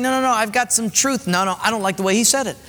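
high, fast whiny griping; mocking complainer. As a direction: mimic a petulant churchgoer who won't listen. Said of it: "But God is saying".

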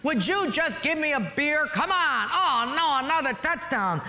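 preacher hams up a beer-belly husband; mock yelling husband. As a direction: holler from the armchair. Husband voiced in joke story.

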